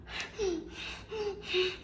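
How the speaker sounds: sharp high gasping inhale. Retold or imitated. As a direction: gulp air in hard on command. Told to breathe harder.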